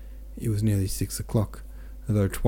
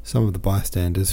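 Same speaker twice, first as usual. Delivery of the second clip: ordinary delivery, nothing marked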